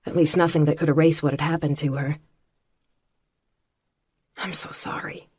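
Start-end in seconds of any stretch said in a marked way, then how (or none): 4.4-5.3 soft, breaking, unvoiced apology; broken whisper. A narrator's reading. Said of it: "I said brokenly".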